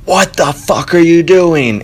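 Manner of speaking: high, drawn-out mock yell; imitated yelling. Podcaster imitating a shout heard from the courtyard, mock yelling. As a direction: yell it out slowly, imitating an outraged neighbor. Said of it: "I hear yelling".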